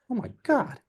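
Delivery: high-pitched shocked reaction; mock scandalized gasp. Lady covering her cross at the test kits, mock shock. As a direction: imitate a lady aghast.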